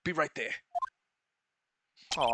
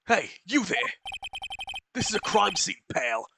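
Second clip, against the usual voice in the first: loud, higher barking cop voice; detective ordering a stranger off the scene, loud and higher; gruff detective yelling; 6 semitones higher, 6 dB louder than usual. Acted. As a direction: shout him off the crime scene. Detective yells at intruder at scene.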